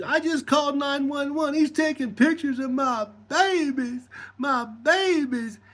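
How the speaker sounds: high-pitched mock wailing, dragging out the end; hysterical mother. Woman accusing him to the Subway kid, hysterical mother. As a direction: imitate a frantic woman crying out.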